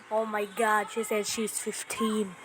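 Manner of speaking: ordinary talk, nothing marked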